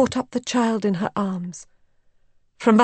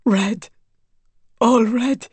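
horror growing louder on the second phrase; stunned horror; 3 semitones higher, 3 dB louder, slower than usual. A narrator's reading. Raoul staring behind the curtain, aghast. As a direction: utter it aghast, in short bursts. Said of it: "he murmured".

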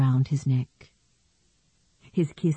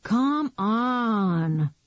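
bored; 6 semitones higher than usual. Acted. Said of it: "Paul urged in a bored voice".

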